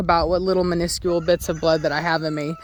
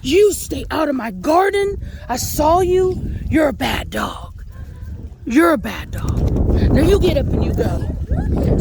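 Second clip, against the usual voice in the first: higher, emphatic dog-scolding voice; pretend-mad scolding; 6 semitones higher than usual. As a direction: scold the dog hard without really being mad. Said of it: "I'm emphasizing my voice so that my dog thinks that I'm mad".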